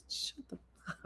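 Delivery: whispered to self. Real, not acted. Repeating the game's name under breath, whispered. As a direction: mouth the name to yourself.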